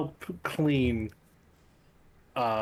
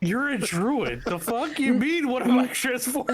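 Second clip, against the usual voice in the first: mock outrage; 9 semitones higher than usual. Real, not acted. Mock-indignant druid protest.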